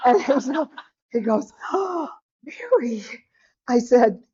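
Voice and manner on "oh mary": high, drawn-out aghast gasp; shocked husband. Retold or imitated. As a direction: gasp the name in mock dismay, high and slow. Husband reacts to love affair confession.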